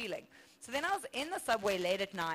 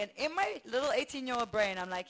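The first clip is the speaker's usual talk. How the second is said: usual speaking voice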